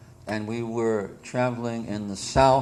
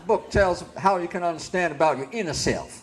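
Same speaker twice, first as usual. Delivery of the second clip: put-on Kentucky country accent, pitched up a little; put-on Southern accent; 5 semitones higher than usual. Book distributor explaining the book, put-on Southern accent. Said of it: "I had to talk like that".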